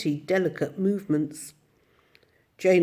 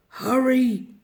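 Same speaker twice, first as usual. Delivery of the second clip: urging voice; 6 semitones higher, 4 dB louder than usual. Acted. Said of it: "urged the voice again".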